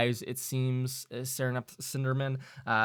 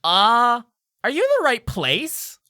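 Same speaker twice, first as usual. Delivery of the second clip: much higher, louder sprightly pixie; 11 semitones higher, 10 dB louder than usual. Acted. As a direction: pipe up high and suspicious like a pixie. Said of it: "pixie will say".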